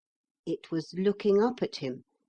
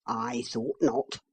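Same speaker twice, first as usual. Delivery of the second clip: horse voice; 6 semitones lower, slower than usual. A narrator's reading.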